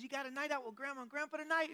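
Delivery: high-pitched imitation of a waitress; talking to a toddler. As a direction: put on a high voice for chatting to a little child.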